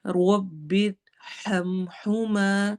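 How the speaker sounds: رب ارحمہما said very slowly, the alif in ہما held long. Shown as a demonstration. Teacher demonstrates the long alif for her students, very slow. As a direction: stretch the final alif of ارحمہما. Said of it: "میں الف کو لمبا کرنا ہے".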